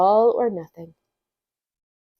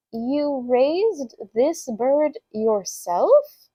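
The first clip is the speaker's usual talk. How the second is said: slow "You raised this bird yourself", rising at the end; questioning; 3 semitones higher, much slower than usual. Acted. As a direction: stretch each word and lift "yourself" into a question. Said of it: "It was a question".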